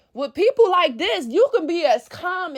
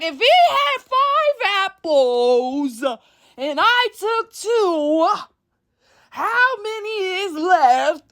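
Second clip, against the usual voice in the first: very slow, higher, exasperated spelling-out; agitated; 5 semitones higher, much slower than usual. Retold or imitated. Losing patience with a stubborn friend, very slowly and agitated. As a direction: drag out each word, fed up. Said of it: "Now you're agitated".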